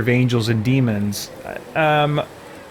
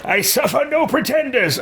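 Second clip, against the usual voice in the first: high-pitched haughty character voice; Elderast; 10 semitones higher than usual. Acted. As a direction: declare it in mock indignation, pitched high.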